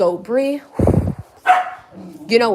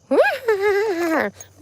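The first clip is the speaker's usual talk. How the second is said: high laugh; 13 semitones higher than usual. Real, not acted. Laughing before the cat sound.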